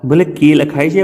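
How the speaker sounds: ordinary delivery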